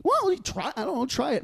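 put-on high, hesitant kid voice; defensive kid. Kid tells friend's mom to taste it, defensive. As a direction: push back up high, unsure. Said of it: "the kid was like".

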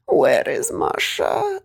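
put-on high, slow, insistent demand from an old Russian granny; granny's demanding voice. Granny phoning mum at night, high and slow demanding. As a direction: draw out the question like a suspicious grandmother.

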